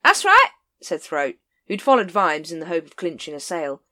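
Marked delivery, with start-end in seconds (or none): 0.0-0.5 high, loud wheedling huckster; shifty street vendor. A narrator's reading. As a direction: play the oily salesman. Throat voiced.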